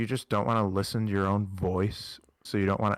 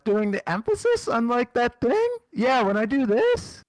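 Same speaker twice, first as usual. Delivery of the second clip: pitched way up, rising with emphasis; high emphatic voice; 10 semitones higher, 4 dB louder than usual. Shown as a demonstration. Podcaster showing co-host how their voice gets high, high and emphatic. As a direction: demonstrate your voice climbing high on emphasis. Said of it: "when my voice gets high".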